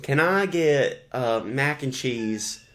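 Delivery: slow, hesitant put-on ordering voice; slow prank order. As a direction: draw the order out innocently.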